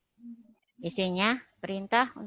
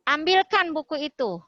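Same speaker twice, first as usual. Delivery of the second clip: "Ambilkan buku itu" pitched higher and louder as an order; raised command intonation; 6 semitones higher, 5 dB louder than usual. Shown as a demonstration. Teacher showing the class an example order, raised command tone. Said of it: "Nah, agak naik".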